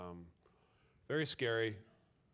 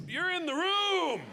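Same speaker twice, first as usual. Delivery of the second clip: much higher, louder and slow; mock alarm; 5 dB louder, slower than usual. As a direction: hammer home the shock, drawn out. Punchline shouted, slowed.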